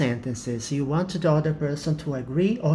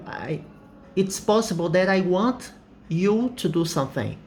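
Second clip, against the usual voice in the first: usual speaking voice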